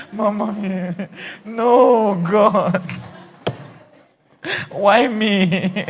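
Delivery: loud high comic wail with laughter; mock lament. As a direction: wail to heaven jokingly.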